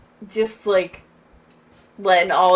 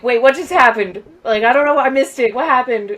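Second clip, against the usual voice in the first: louder, higher-pitched bewildered viewer; acted confusion; 4 semitones higher, 5 dB louder, faster than usual. Podcast guest playing a viewer who missed the action, confused. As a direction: blurt it out as a lost viewer.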